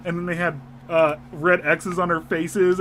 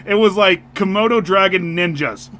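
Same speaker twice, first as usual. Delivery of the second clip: usual speaking voice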